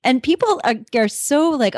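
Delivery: ordinary talk, nothing marked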